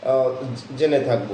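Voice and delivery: usual speaking voice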